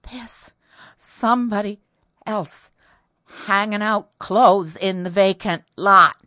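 halting, broken by gasps, building to a loud finish; fainting, gasping voice. A narrator's reading. Terrified maid tells the family, gasping and faint at first. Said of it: "Cordelia spoke in a fainting voice".

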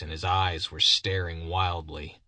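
ordinary delivery, nothing marked

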